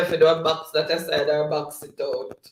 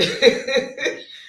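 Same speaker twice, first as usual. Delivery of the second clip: airy laugh with no voice in it; breathy laugh; 10 semitones higher, 4 dB louder than usual. Real, not acted. Host reading David's reply laughs in an airy breath.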